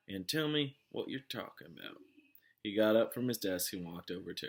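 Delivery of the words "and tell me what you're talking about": lazy drawling sheriff voice; slow drawl. A narrator's reading. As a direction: take your time, unbothered. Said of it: "slow drawl".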